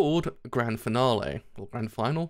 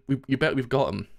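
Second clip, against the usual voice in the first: ordinary delivery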